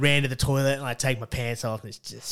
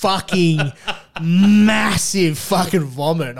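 loud, high and drawn out for comic horror; slow, booming storyteller's exaggeration; hammed-up emphasis; 6 semitones higher, 7 dB louder, much slower than usual. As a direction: stretch it out big, like a punchline. Hammed-up climax of vomit story.

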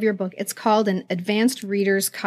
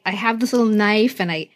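ordinary talk

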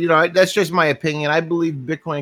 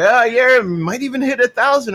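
excited; 9 semitones higher, 4 dB louder than usual. Retold or imitated. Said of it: "and we're going".

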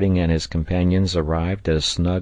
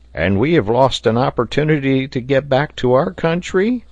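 higher, put-on character voice; dismayed question; 7 semitones higher, 4 dB louder than usual. A narrator's reading. Neb hears the ship has left, dismayed question. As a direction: ask it in a raised voice, dismayed.

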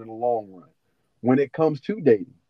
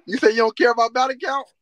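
incredulous; 8 semitones higher, 7 dB louder than usual. Real, not acted. Shocked at friend's claim.

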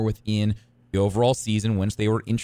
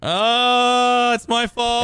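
high, loud fake apology; mock guilty; 12 semitones higher, 6 dB louder, much slower than usual. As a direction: play the guilty one. Mock contrite reply.

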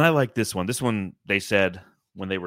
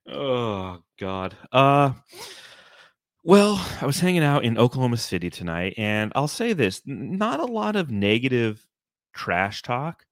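ordinary delivery

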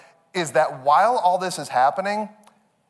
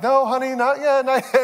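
high, sing-song reassurance; gently calm the kid, pitched up; soothing dad; 8 semitones higher than usual. Retold or imitated. Dad soothes hungry daughter in the car, high sing-song reassurance.

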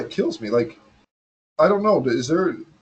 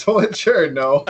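laughing; 10 semitones higher than usual. Real, not acted. Echoing the joke, laughing.

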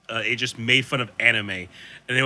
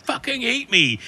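sandwich voice; 10 semitones higher than usual. Retold or imitated. Sandwich voiced in Chowder retelling.

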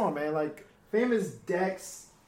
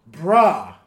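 ordinary talk, nothing marked

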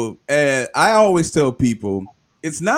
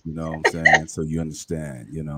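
deep voice; 8 semitones lower, 6 dB quieter than usual. Shown as a demonstration. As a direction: take it down a couple octaves. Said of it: "take it down a couple octaves".

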